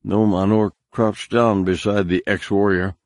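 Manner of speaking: ordinary delivery, nothing marked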